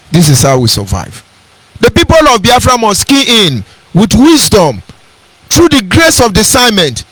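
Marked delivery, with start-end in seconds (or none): 1.8-7.0 higher, ringing sermon voice; lifted pulpit delivery; fervent preaching. Acted. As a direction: preach it to the crowd.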